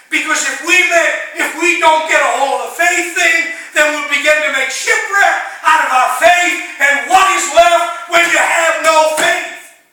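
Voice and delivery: high, loud preaching cry; full-force preaching. Acted. Preacher warns the church, loud and high. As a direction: preach it hard to the back row.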